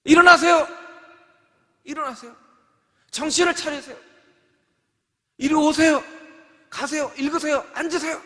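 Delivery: high, loud stern calls; loud urgent orders; urgent commands. Shown as a demonstration. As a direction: rouse the congregation with commands. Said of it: "어떻게 얘기를 해야 되겠습니까".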